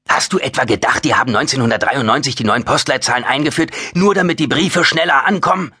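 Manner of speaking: high, incredulous rhetorical question; rising, worked-up disbelief at such naivety. A narrator's reading. Oskar's agitated conspiracy rant.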